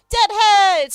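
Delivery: loud, high greeting yell; excited shout. Real, not acted. Streamer greeting a viewer arriving, excited shout. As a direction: call out the name with joy.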